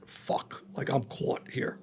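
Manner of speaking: hushed, slow whisper of panic; muttered under the breath in fright; panicked whisper. Retold or imitated. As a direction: whisper it like you've just been caught. Caught at the apartment, panicking.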